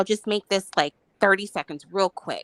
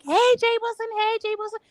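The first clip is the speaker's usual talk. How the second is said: high excited call; 12 semitones higher, faster than usual. Retold or imitated. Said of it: "and she was like".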